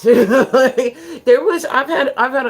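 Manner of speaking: high loud laughter spilling into the words; laughing. Real, not acted. Streamer laughs out loud at the bug bust headline.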